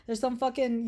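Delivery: normal talking voice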